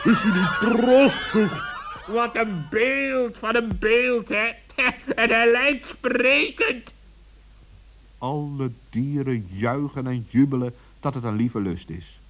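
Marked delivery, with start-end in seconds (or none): 0.0-6.9 delighted cheering. Acted. Animals cheer the unveiled statue.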